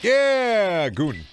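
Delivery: long, high, loud yell; triumphant shout. Real, not acted. Player yells at a goon mid-fight, triumphant shout. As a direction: roar it out over the fight.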